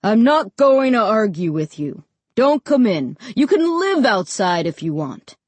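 firm, fed-up giving in; exasperated. A narrator's reading. Dad lets his son stay outside, exasperated. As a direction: lay it down with contained annoyance. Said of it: "He sounded really exasperated".

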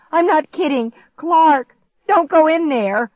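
high, loud urgent warning; panicked shriek. A narrator's reading. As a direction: shriek to stop someone. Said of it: "I shrieked".

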